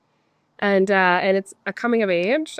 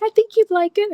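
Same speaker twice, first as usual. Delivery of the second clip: mom voice; 13 semitones higher, 5 dB louder than usual. Retold or imitated. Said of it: "She's like".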